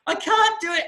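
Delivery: high, loud panicked cry; mock panic. Student jumps back in the plane, imitated panicking. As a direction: imitate someone losing their nerve. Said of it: "And she loses it".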